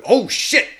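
sudden high startled exclamation; mock shock. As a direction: yelp it out like a jump scare just hit. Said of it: "And you go".

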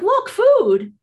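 very high, loud sing-song coaxing; coaxing offer. Retold or imitated. She offers the robin worms in a high coaxing voice. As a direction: lure a pet with a treat.

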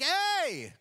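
a bemused, exasperated God, stretching the last lines out; God's exasperated voice. Retold or imitated. God looking at a stubborn believer, high-pitched exasperated scolding. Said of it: "God's looking at you like".

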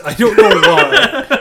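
high, loud, slow words through real laughter; cracking up while getting the words out; laughing. Real, not acted. Podcast host laughing at his own joke.

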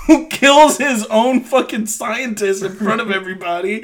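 ordinary delivery

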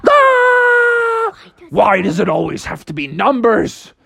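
building from dazed murmur to high scream; acted mounting panic; overwhelmed by numbers. Head spinning, building to a scream.

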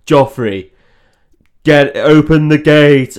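loud, slow shouted order; barked order. Retold or imitated. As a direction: call it out loud and slow like a boss on site. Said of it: "he goes".

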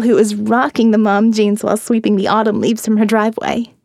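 ordinary delivery

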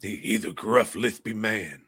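gruff, lispy impression; Danny Glover. Shown as a demonstration. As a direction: show a gruff man with a lisp. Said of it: "kinda gruff, been with a lisp".

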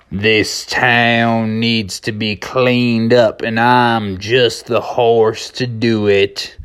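slow southwestern cowboy drawl. Acted. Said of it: "talking with a nice southwestern drawl".

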